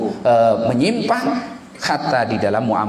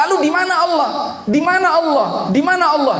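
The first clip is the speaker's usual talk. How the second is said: repeated outcry; faster than usual. Retold or imitated. Shepherd refuses Ibnu Umar's offer, high outcry. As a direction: hammer the question again and again. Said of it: "Dia ulang-ulang begitu".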